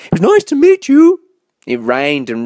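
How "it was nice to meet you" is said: high, loud polite farewell; high-pitched bird character; owl character. A narrator's reading. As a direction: call it out as a departing owl. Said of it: "he said".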